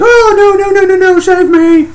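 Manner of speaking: very high, loud squealing plea; mock panicked screaming. Victim dragged off by alien, hammed-up panic. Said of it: "this guy's all like".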